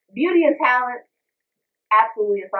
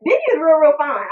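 higher and louder, swooning over him; excited gush; 7 semitones higher, 5 dB louder than usual. Real, not acted. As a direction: gush like a fan with a crush. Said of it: "gushing over [name]".

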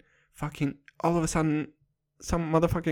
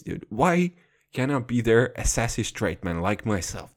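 ordinary talk, nothing marked

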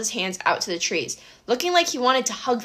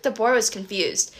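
ordinary delivery, nothing marked

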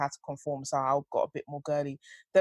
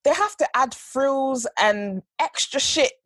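exasperated rant; 5 semitones higher, 7 dB louder than usual. Real, not acted. Ranting about women's clothes.

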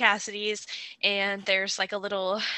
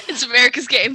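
louder announcer voice, laughing; game show announcer voice; 3 semitones higher, 10 dB louder than usual. Retold or imitated. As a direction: proclaim it like a TV host, giggling. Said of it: "That's what they say at the beginning".

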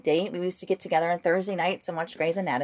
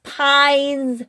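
drawn-out, louder and higher, word by word; slow clarifying repeat; 6 semitones higher, 4 dB louder, much slower than usual. Retold or imitated. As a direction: spell it out slowly for a confused listener. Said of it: "he's like".

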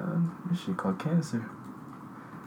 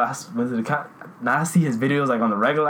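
usual speaking voice

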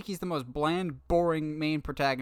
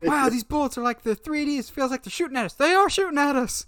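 higher, building mock panic; imitating Murdoch; 10 semitones higher, 3 dB louder than usual. Host quoting Murdoch under fire, high and rising. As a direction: realize mid-line they're shooting. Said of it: "Murdoch is just the best".

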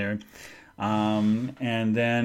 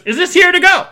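high, loud sing-song greeting; bright pushy waitress sing-song; chirpy server voice; 12 dB louder than usual. Retold or imitated. Server greeting customers at the counter, high sing-song. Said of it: "And he goes".

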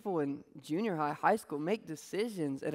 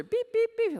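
very high, loud quick beeps; faster beeping; 4 dB louder than usual. Retold or imitated. The collar beeping at the dog, high frantic beeps. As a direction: squeak out the collar alarm. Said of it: "And it gets faster".

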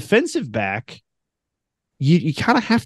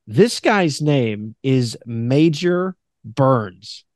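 slow reveal; much slower than usual. Real, not acted. Slow reveal of a joke name.

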